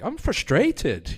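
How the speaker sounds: frustrated in a put-on British accent, high and drawn out. Shown as a demonstration. Comedian showing how he talks after weeks in England, British accent. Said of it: "I kinda pick up accents quickly".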